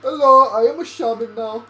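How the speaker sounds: loud mock-Indian accent; hammy shaman with an attempted Indian accent; broad, shaky-authenticity Indian-accent impression. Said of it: "I was gonna do the shaman voices".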